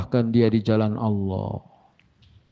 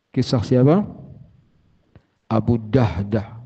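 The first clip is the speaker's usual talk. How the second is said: normal talking voice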